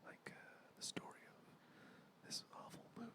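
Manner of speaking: mock ASMR whisper. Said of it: "just whisper".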